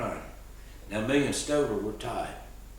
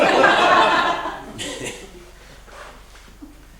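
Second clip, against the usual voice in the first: high breathy laugh; 7 dB louder than usual. Real, not acted. Laughing at own joke.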